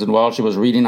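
usual speaking voice

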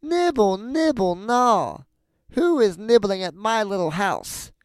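high, loud sing-song witch voice; witch calling out. A narrator's reading. Witch calls from the room to nibblers, high witch cry. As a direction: call out high, falling off on each phrase. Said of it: "a soft voice cried from the room".

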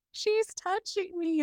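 high, slow, whiny tattle; whiny tattling little sibling. Retold or imitated. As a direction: complain like a little kid telling on her sister. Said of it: "And she was like".

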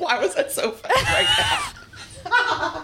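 high-pitched helpless laughter; words broken by a laughing fit; laughing hard. Real, not acted. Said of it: "I just busted out laughing".

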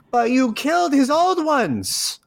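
high-pitched, outraged objection; indignant protest. Acted. Ranting to God about the killed children, indignant. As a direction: protest as the voice shoots up. Ranting against God's bargain.

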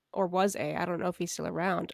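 ordinary talk

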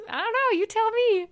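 a flirty high mock-innocent comeback; coy retort. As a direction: toss it back playfully. Coy retort to his question.

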